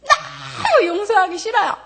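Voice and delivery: high, loud reenacted yelling prayer; a partial re-creation of the screaming prayer; retold monster yell. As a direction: yell it out like she did that night. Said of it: "소리를 막 괴물처럼 지르면서".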